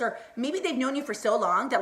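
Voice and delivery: usual speaking voice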